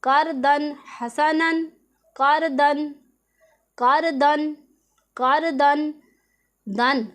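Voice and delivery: slow recitation of करदन हसनन with a clear नून; Quranic recitation. Shown as a demonstration. Teacher drilling the example for her students. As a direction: repeat करदन, sounding the नून plainly. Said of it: "इजहार करना है".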